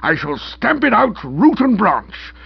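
high, loud magistrate's tirade; strong remarks from bench. A narrator's reading. Magistrate condemning the police before his nephew, outraged. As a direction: thunder indignantly as if from the bench. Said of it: "give Stilton the strong remarks from the bench".

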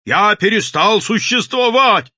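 high, loud, drawn-out cry; straining shout. A narrator's reading. Madman announcing his disappearance to the crowd, straining shout. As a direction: proclaim it at the top of the voice. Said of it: "надсаживался человек".

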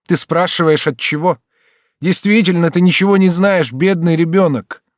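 high, loud, agitated exclamation; bitter agitation. A narrator's reading. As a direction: build from indignant question to bitter cry. Mother's bitter outburst building. (с горьким возмущением, повышая голос)

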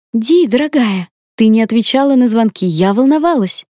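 put-on high, gushing girlfriend voice; bubbly sorority girl. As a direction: gush like an excited best friend. Friend Camilla bursts in worried.

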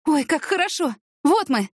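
urgent, high-pitched report; blurting out. A narrator's reading. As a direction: blurt the facts to a medic. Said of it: "выпалила она врачу в окно". (выпалить взволнованно, на повышенном тоне)

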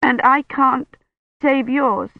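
high, sob-choked words; voice catching in a sob. A narrator's reading. Kivrin thanks dying Roche, high, voice catching in a sob. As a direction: let the sob break through.

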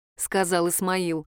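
ordinary delivery

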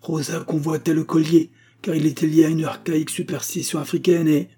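high, insistent accusation; accusing. A narrator's reading. Old maid accusing Rosa, cut off. (ton accusateur, aigu)

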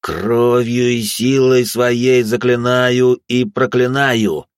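very slow, loud chanted curse; incantation. Acted. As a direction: intone the spell with power. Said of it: "продолжил я".

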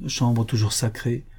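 ordinary delivery, nothing marked

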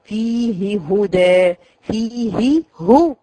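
slow, drawn-out Quranic recitation of فيه هدى with the ha clearly breathed; careful recitation demo. Shown as a demonstration. Teacher recites فيه هدى slowly for the girls. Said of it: "في اول سورة البقرة".

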